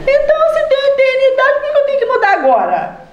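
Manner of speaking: high, lightly voiced excuse; mock careless believer. Preacher voicing a careless believer's excuse, high and mocking. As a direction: play the lazy soul putting off change.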